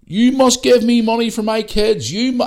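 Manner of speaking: high-pitched, loud imitation of a shouting woman; accented screaming. Woman demanding money from the guy in the next booth, screaming. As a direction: slowly scream it out, mimicking a foreign accent. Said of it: "with an accent screaming and shouting".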